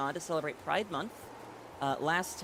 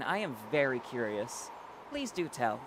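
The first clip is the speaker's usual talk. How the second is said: usual speaking voice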